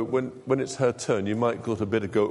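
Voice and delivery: usual speaking voice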